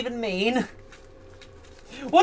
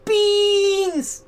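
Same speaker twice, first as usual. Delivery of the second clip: excited squeal; 8 semitones higher than usual. Real, not acted. Excited about toe beans.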